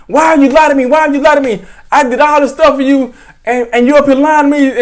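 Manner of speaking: high, hurt mock scolding; imitated tearful reproach. Grandmother reproaching the cousin who lied, mildly tearful. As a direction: lightly imitate a grandmother crying over a lie. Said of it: "she was crying. She was like".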